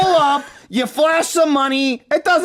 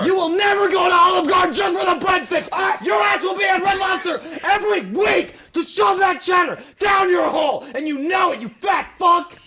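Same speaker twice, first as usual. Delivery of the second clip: mock debate shouting; 5 semitones higher than usual. Arguing for cheddar biscuits, shouting at a friend. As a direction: hammer the point like a debater. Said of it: "persuasive argument slash shouting".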